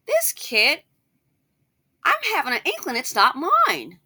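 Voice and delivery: high, loud voice of a man with doubts; doubting father. Acted. Suspicious man questions whether the kid is his, doubting.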